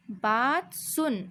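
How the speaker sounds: slow, with सुन drawn out and falling; slow reading demo. Shown as a demonstration. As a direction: stretch सुन out slowly for the class. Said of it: "ध्यान से सुनिए". (धीरे से, सुन खींचकर)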